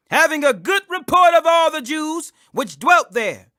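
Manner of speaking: plain reading voice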